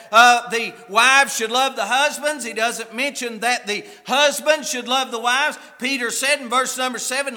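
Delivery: loud, rolling scripture recitation; full-voiced preaching. Real, not acted. Preacher citing Peter's verse to husbands, full-voiced preaching. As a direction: declaim it firmly from the pulpit.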